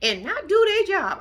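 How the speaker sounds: high-pitched indignant older relative impression; mock high-voiced complaint; imitating Mama Joyce. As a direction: pitch it way up, scolding in character. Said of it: "Mama Joyce has something to say".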